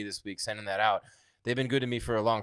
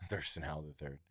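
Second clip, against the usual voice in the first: laughing through it, much softer; 8 semitones lower, 13 dB quieter than usual. Real, not acted. Host amused by a viewer's nickname, laughing. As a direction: giggle the name out under your breath.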